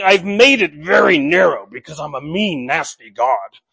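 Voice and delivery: lightly growled mock nasty God, dropping low; a hint of a sneering, mean God. Preacher mocks a mean God to his congregation, a low sneer. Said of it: "it's not that God is saying".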